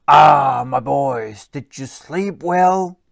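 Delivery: booming fatherly greeting; loud cheerful troll father; hearty troll dad. Acted. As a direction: greet the boys like a big jolly troll dad. Daddy Troll greets sons.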